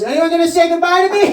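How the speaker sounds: high, loud cry for attention; indignant yell. Acted. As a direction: call out to everyone ignoring you. Ignored guest calls out to party.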